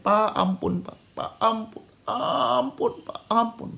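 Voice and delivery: put-on high, weakening child's plea; imitated tearful begging. Ant-bitten son begging his father, mock-crying plea. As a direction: plead like a boy losing consciousness. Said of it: "Jadi sambil dia nangis".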